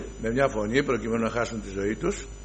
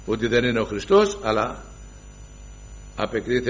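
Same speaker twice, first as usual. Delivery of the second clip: usual speaking voice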